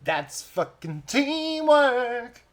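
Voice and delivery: high, loud, drawn-out celebration; real triumphant cheer. Real, not acted. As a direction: cheer it high and stretch the last word. Celebrating a shared kill.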